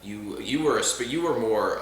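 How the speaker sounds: ordinary talk, nothing marked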